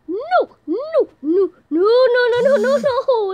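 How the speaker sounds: loud high repeated no, near crying; terrified screaming. Real, not acted. Gamer screaming as he is caught. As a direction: scream in panic.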